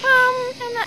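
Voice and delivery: high, hesitant mock boyfriend um; a nervous boyfriend stalling in a high voice; stuttering boyfriend. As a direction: play the timid boyfriend stalling. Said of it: "and he's stuttering".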